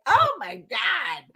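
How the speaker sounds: high, slow, stunned exclamation; retold shocked reaction. Reliving the child's voice in the night, high shocked exclamation. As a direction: draw it out high in mock shock.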